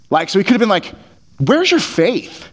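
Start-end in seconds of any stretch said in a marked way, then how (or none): 1.4-2.5 "Where's your faith?" pitched way up, slow and disbelieving; incredulous. Shown as a demonstration. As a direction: ask "Where's your faith?" as if you can't believe they still doubt. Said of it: "Kind of incredulous".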